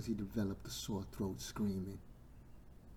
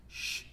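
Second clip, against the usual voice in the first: hushing shush. Acted. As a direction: hush someone down. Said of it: "didn't want him to say nothing".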